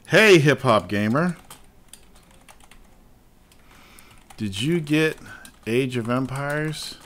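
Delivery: slow, spaced-out dictation; typing aloud. Real, not acted. Tweeting Hip Hop Gamer, slowly reading aloud while typing. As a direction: say it slowly as you type it out.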